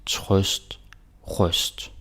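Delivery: "trøst" with an open ø like the vowel in "thought"; Danish pronunciation. Shown as a demonstration. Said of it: "this one is trøst".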